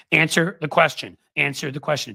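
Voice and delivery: retold shouting, half-hearted; mock yell. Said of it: "She even starts screaming".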